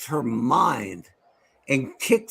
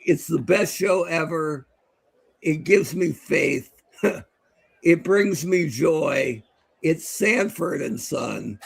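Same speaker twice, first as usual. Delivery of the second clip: slow, solemn mock recital; dramatic slow reading; slower than usual. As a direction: read it like a sacred tribute. Classmate reads joke essay aloud.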